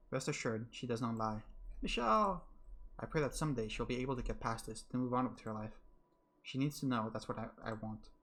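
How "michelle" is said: high, loud cry of his name; anguished cry. Acted. Giselle crying out to Michelle in anguish, high and loud. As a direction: scream his name in panic.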